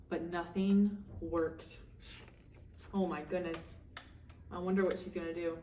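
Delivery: soft, low "oh my goodness"; hushed worry. Real, not acted. Reader wonders aloud to the children, softly worried. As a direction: drop the voice to a worried hush. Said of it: "reader's worried aside".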